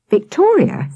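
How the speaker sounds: ordinary delivery, nothing marked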